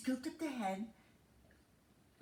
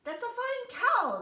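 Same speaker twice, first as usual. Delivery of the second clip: high, loud put-on voice for the man; sly little man offering a trade; man's voice; 12 semitones higher, 7 dB louder than usual. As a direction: play the bean seller high and bright. Man character voiced in story.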